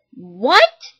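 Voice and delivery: loud stunned outburst; shocked what. Acted. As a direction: blurt it in disbelief. Son shocked by news.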